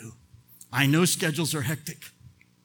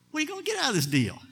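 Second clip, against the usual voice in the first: "What are you gonna get out of this deal?" pitched up and rushed; high, fast rhetorical question; 10 semitones higher, 5 dB quieter, faster than usual. Shown as a demonstration. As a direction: toss the question up high and quick.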